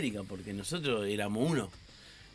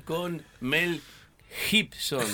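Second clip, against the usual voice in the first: dubbing announcer stress on Gibson; emphatic dub voice; 5 semitones higher, much slower than usual. Retold or imitated. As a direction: punch the name like a trailer voice. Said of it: "Le daba mucho énfasis".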